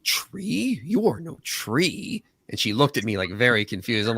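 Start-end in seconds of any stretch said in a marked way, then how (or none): 0.0-2.2 high, slowed, incredulous quote; quoted movie line. Retold or imitated. Patient making a nerd joke to the ENT doctor, high slow disbelief. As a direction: draw it out high in mock disbelief. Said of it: "I was like".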